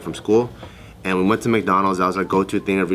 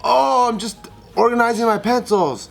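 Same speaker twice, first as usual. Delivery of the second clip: high-pitched mock-innocent child; retold boy's feeble excuse in a high voice; innocent kid caught out; 13 semitones higher than usual. Caught kid to dad in bathroom, high innocent excuse.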